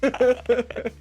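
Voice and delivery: high, loud and laughing, slowed; giggling through an awkward answer. Real, not acted. Laughing at the dilemma.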